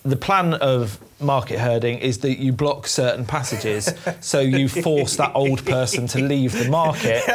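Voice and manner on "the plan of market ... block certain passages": ordinary talk, nothing marked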